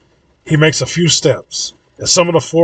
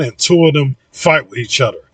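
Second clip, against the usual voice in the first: ordinary delivery, nothing marked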